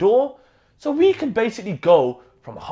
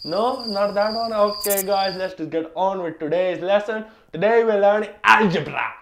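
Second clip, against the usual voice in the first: put-on Indian accent. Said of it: "Mister Singh".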